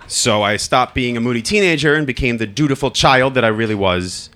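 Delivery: usual speaking voice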